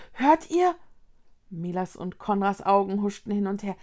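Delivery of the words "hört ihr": high, louder urgent question; spellbound. A narrator's reading. Vicky asks her friends to listen, spellbound. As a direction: ask it urgently, pitched up.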